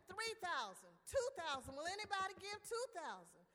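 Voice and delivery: auctioneer calling. A narrator's reading. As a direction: cry out the opening bid to the room.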